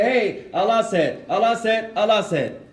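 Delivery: overbearing mock repetition; mock pushy preaching. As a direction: caricature someone hammering the same line. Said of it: "You can't always just come and say".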